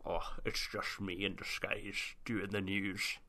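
put-on a lightly held Scottish accent, murmured; Sean Connery voice. Recapping the episode in a soft Scottish accent. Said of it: "I've got to stop doing the accent".

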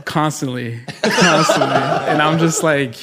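giggling while answering; laughing. Real, not acted.

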